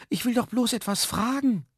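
very high-pitched protest; pleading call. A narrator's reading. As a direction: call after them, high and imploring. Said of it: "rief Herr Jaromir". (hoher, flehender Ausruf)

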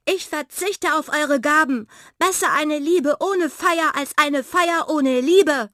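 high, loud, resolute proclamation; belted out. A narrator's reading. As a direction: proclaim it to all with full voice. (entschlossen geschmettert)

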